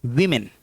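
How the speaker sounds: वीमेन said "wi-min", both vowels short I; correct pronunciation. Shown as a demonstration. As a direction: open वीमेन with "wi", not "wo". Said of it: "नहीं".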